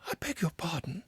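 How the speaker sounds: a small, startled creature apologising; a hint of a shy little Mole. A narrator's reading. Mole's voice, polite apology.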